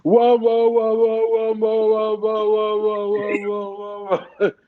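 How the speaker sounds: high, loud run of garbled whoas; imitated heckler. Audience member disrupting the singer on stage, imitated heckler. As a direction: mimic a woman wailing along from the crowd. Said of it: "someone in the audience that was doing this".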